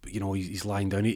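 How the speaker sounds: plain reading voice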